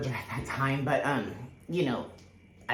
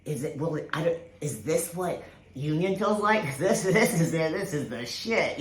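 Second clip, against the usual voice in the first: retold giddy rush of joy, rising high and louder with a laugh; half-laughing, overwhelmed realisation; excited disbelief; 4 semitones higher, 3 dB louder than usual. Reliving her union moment to viewers, excited laughing disbelief. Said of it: "you're just like".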